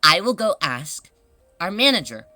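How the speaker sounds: measured, polite customer-service voice with deliberate pauses; model shop employee. Shown as a demonstration. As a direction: demonstrate the proper answer phrase by phrase. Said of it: "I would say".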